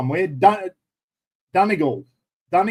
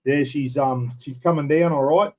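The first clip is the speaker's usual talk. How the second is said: ordinary delivery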